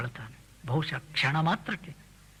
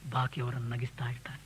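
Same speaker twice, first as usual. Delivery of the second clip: whisper; 7 semitones lower, 8 dB quieter than usual. Real, not acted. Soft close of a point.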